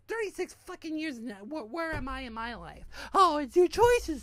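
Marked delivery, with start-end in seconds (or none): 3.1-4.2 loud, higher, sarcastic mimicry; mocking imitation of critics. Imitating people who blame him for his choices, mocking. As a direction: throw their blame back loudly, mocking.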